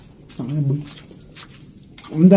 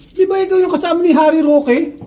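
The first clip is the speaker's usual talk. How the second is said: imitating a stranger in a much higher pitch; high, eager mock questioning; curious stranger; 11 semitones higher than usual. Stranger asking if he is that vlogger's companion, high and curious.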